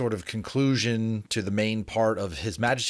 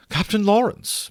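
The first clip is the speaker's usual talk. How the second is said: much higher, louder sweet greeting; Miss Montague, gushing; 13 semitones higher, 5 dB louder than usual. Acted. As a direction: call him with false sweetness. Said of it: "smiling with a great deal more friendliness".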